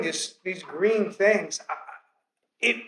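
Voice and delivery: normal talking voice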